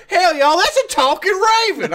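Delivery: Southern Poe laughing. Acted. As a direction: crack up while hamming a Southern accent. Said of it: "Edgar Allan Poe if he was from the Deep South".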